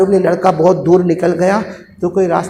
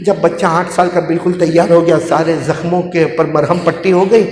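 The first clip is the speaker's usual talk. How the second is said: usual speaking voice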